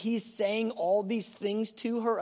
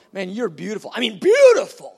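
building to a loud high whoop; over-the-top thrilled admirer; ecstatic Jesus; 6 semitones higher, 12 dB louder than usual. Acted. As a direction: gush then cheer with delight. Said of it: "do you imagine him going".

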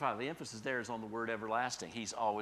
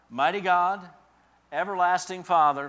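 Mighty God called out higher and louder; proclaiming; 7 semitones higher, 13 dB louder than usual. Acted. Preacher crying out God's names to the church, loud. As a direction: ring out the divine names. Climax of the reading.

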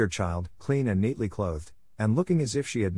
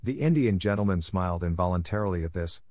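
plain reading voice

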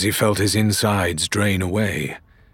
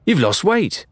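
higher, fond remark; fond barmaid; 10 semitones higher, 3 dB louder than usual. A narrator's reading. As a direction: greet him with affection. Old friend greets captain.

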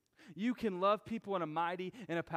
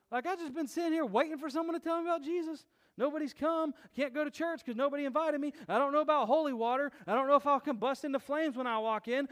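a lightly raised, pleading seeker's voice; eager seeker voice; 6 semitones higher than usual. Retold or imitated. Preacher voicing a churchless seeker, lightly higher and pleading. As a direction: play the person waiting to hear about Jesus, higher and eager.

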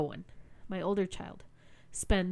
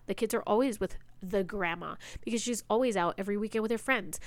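usual speaking voice

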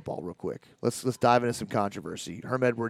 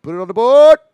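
loud booming radio call; announcer home run call; 10 semitones higher, 16 dB louder than usual. Retold or imitated. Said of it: "He says like this".

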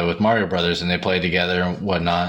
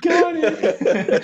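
laughing mimic. Real, not acted. Mimicking friend while laughing.

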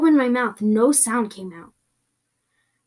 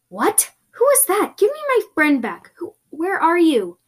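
high, raised-voice demand; alarmed demand; 7 semitones higher, 5 dB louder than usual. Acted. To a creepy voice that took a friend, demanding shout. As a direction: shout at an unseen kidnapper.

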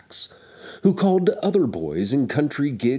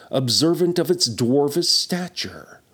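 plain reading voice

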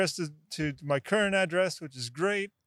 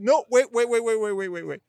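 panicked calling out; urgent interruption; 6 semitones higher than usual. Retold or imitated. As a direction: stop him before he hangs up.

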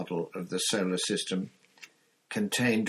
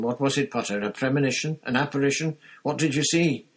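rising, louder, breathless eagerness; eager and excited; 5 semitones higher, 5 dB louder, faster than usual. A narrator's reading. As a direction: press him, thrilled and hungry. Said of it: "looking thoroughly excited".

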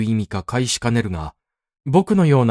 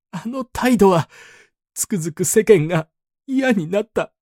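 higher, slurred sobbing grievance; tearful complaint; 6 semitones higher than usual. A narrator's reading. Humiliated patient sobbing his grievance to the elder. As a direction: pour out a hurt, blubbering complaint. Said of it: "ろれつの回れぬ口調でくどくどと訴え".

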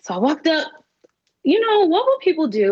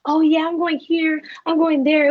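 mock bragging classmates; 6 semitones higher than usual. Mimicking excited classmates.

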